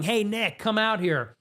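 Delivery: half-hearted imitation of yelling; retold yell. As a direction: imitate someone calling you out, at talking volume. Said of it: "so he starts yelling".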